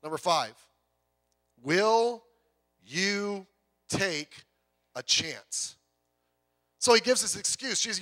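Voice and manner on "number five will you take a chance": each word set apart by long pauses; pauses between words. Real, not acted. Pastor posing the fifth point, word by word with pauses. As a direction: drop lower and let every word hang.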